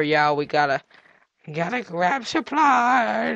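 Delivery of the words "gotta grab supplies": slow, higher-pitched stretched words; drawn-out. Pretend. Player gathering supplies for winter, drawn out and high. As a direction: stretch the last word right out.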